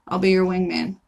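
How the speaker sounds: ordinary talk, nothing marked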